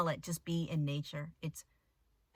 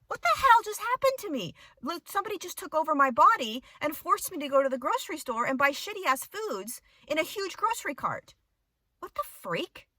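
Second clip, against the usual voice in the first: shocked disbelief; 10 semitones higher, 7 dB louder than usual. Retold or imitated. On the couch after shopping, loud shocked disbelief. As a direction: cry out in stunned disbelief. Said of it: "I said".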